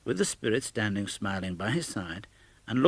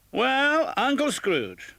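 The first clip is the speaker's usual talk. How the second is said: toast cried out; much slower than usual. A narrator's reading. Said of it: "they cried".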